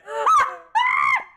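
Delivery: high, loud shrieking laugh; sudden loud cackle. Real, not acted. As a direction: let out a squealing cackle. Burst at chat joke.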